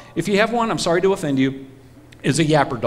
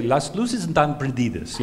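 Spanish; 4 semitones lower, 4 dB quieter than usual. Retold or imitated. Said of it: "maybe in Miami".

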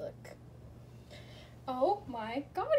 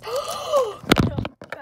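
loud excited gasp; 12 semitones higher, 10 dB louder than usual. Real, not acted. Opens rarest figure.

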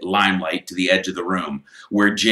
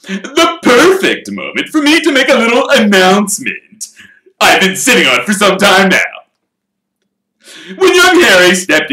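showman voice; 11 semitones higher, 10 dB louder than usual. Acted. Lockhart's grand speech.

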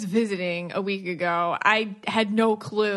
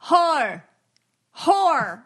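mimicking Long Island accent; 5 semitones higher, 4 dB louder than usual. Teasing her husband about how he says horror, in a Long Island accent. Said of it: "I come downstairs and you're like".

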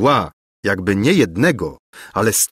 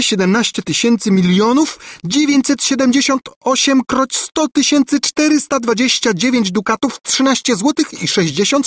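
high, excited character voice; scheming governess, eager; 10 semitones higher than usual. A narrator's reading. Governess planning escape with the prince, eager. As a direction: lay out the plan eagerly, pitched high.

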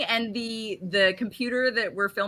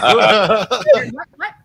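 confused; 9 dB louder, much slower than usual. Retold or imitated. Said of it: "And I'm like".

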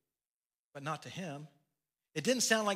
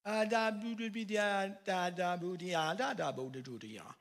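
put-on toddler babble; 2 semitones higher than usual. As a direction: mimic toddler gibberish, sing-song. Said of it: "he just looks at her and says".

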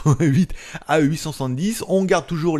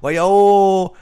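louder, higher mock Chinese accent; bad Chinese accent; 6 semitones higher, 9 dB louder than usual. Ordering the voice assistant in hammed-up Chinese accent. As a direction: bark the command in a clumsy Chinese accent. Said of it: "Avec mon accent de psychopathe".

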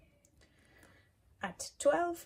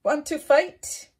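high, loud, cheeky challenge; grouchy ladybug voice; 6 semitones higher, 14 dB louder than usual. Acted. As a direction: pick a fight in a squeaky bug voice. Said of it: "said the grouchy ladybug".

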